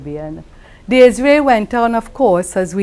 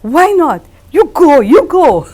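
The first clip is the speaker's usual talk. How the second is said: 1 quoting Desiree; 8 semitones higher, 7 dB louder than usual. Retold or imitated. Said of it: "she says".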